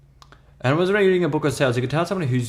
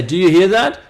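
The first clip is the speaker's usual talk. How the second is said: a loud, higher, hammed-up sales-trick question; mock pitch line; 7 semitones higher, 9 dB louder than usual. Telemarketer retelling a cringy sales trick to a caller, mock loud pitch. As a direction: play the cheesy phone trick, louder and higher.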